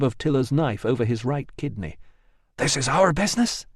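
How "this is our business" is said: hissing. A narrator's reading. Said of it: "hissed Tiller in his ear".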